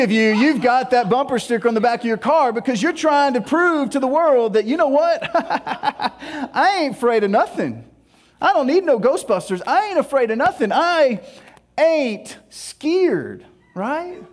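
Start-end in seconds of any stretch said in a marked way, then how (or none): 4.7-13.5 higher, laughing mock bravado; tough-guy bravado. Mocking drivers with the bumper sticker, cocky tough talk. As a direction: puff up like a fearless tough guy.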